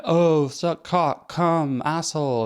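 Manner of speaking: high, slow mocking voice; mock crude quote. Mocking a crude speaker in a high, slow voice. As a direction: drag out a crude caricature line. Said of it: "not just all like".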